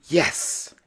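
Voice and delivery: breathless whisper; whispered yes. Acted. The storyteller cheering the happy ending in a whisper. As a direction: whisper it with quiet delight.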